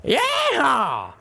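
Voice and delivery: high whooping cowboy yell; triumphant yeehaw. A narrator's reading. Calamity whooping as he flutters back to the group, triumphant. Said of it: "Calamity cried out triumphantly".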